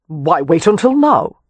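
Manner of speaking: impatient. A narrator's reading. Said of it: "Leo asked impatiently".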